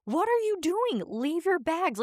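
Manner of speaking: high, exasperated mock yell; imitating scolding mom. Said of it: "And my mom's like".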